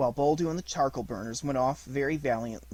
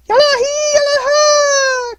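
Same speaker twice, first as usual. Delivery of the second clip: wolf hunting call; 15 dB louder than usual. A narrator's reading. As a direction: call out like a wolf driving the herd. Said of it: "call for the buck diving".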